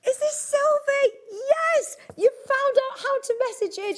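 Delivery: high and loud, delighted recognition; thrilled to spot a familiar viewer; excited. Real, not acted. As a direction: squeal out her name with glee. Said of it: "excited recognition of viewer".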